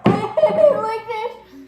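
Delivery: loud, slow wail of disgust; whiny crying protest. Real, not acted. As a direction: cry out that it's awful. Kid tasting gross bean.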